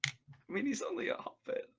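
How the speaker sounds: whispered, soft, out of character; whispered aside. Real, not acted. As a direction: mutter it under your breath. Quiet aside to GM.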